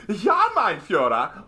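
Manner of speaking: high, slow, hammed-up German accent; drawn-out effeminate German caricature; very camp German. Shown as a demonstration. Streamer demonstrates the camp one of three Germans, camp German accent.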